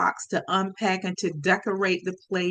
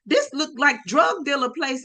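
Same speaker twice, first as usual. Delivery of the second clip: raised, high-pitched imitation of dad's tirade; talking loud; 6 semitones higher than usual. Dad going off about the drug dealer place, talking loud. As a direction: keep dad's loud rant rolling. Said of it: "Talking loud".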